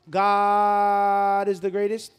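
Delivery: slow, loud drawn-out call; mock prayer call. Mocking the translated call to prayer, slow and loud. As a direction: call it out slowly as if from the minaret.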